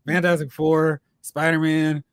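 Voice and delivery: ordinary delivery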